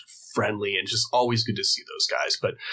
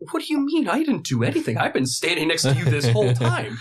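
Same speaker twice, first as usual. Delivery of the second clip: starts far higher, then settles; baffled protest; 6 semitones higher than usual. Retold or imitated. Answering his girlfriend's accusation, baffled high protest. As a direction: squeak out innocent disbelief.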